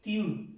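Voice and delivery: tiu said high as a guide to the French U; tiu, with the i very short; tiu approximation. Shown as a demonstration. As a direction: slide quickly through the i into u. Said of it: "Como si dijéramos".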